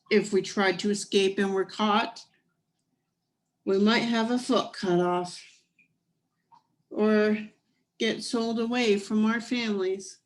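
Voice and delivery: lively storybook reading voice; story narration. A narrator's reading. Reading a picture book aloud.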